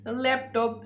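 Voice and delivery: ordinary delivery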